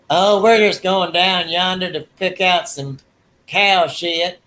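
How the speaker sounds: a lightly voiced Southern drawl; Southern accent. Shown as a demonstration. Comparing accents down here, light Southern accent. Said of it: "how all accents would be".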